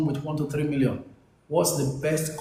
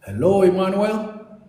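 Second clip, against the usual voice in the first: loud, raised hello to the caller; to someone hard of hearing; 4 semitones higher, 5 dB louder than usual. Real, not acted. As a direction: call out to reach him. Calling a muted caller on air.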